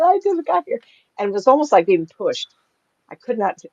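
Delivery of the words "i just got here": high-pitched mock objection; retold protest. Shopper objects to being told to leave, mock protest. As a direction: protest high, half amused.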